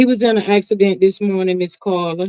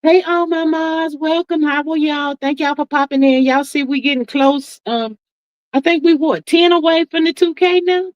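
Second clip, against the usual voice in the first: excited greeting; 7 semitones higher than usual. Real, not acted. Livestream greeting to viewers.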